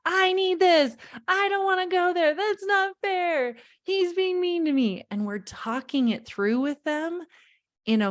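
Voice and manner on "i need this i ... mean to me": put-on high whining child voice; whiny kid. Parent mimicking a child's demands, high and whiny. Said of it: "they're like".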